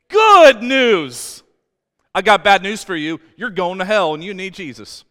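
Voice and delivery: loud, high, fervent preaching; impassioned pulpit preaching; full-force preaching. Acted. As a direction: preach it out to the back row. Preaching loud about God's kindness.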